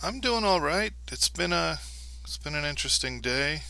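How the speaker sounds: mildly put-on chipper host. Host answers the bro.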